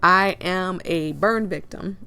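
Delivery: ordinary delivery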